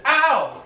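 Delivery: high loud yelp; sharp cry of pain; just got slapped. Acted. Slapped again.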